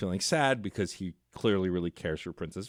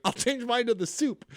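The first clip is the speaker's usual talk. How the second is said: higher quick imitated diner voice; mock diner; 14 semitones higher than usual. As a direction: lightly mimic a nervous diner. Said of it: "He's like".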